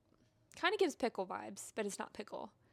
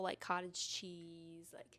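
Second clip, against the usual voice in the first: slow unvoiced whisper, "cottage cheese"; 5 semitones lower, 7 dB quieter, much slower than usual. Real, not acted. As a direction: breathe it out as a secret. Whispered aside.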